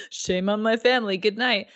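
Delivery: smug, dismissive mock brother voice, with a snicker; a hint of a smug brother sniffing at shame. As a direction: sneer it like a self-satisfied bro. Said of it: "bro of a brother that's like".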